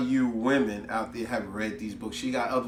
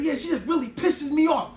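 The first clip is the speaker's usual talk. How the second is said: 1 fed-up venting; 12 semitones higher, 4 dB louder than usual. Retold or imitated. Venting to friend.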